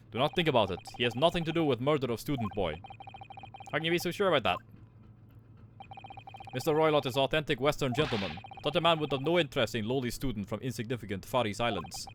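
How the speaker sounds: put-on proud guard in a Russian accent. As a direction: boast about first class in a Russian accent. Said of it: "That was harsh".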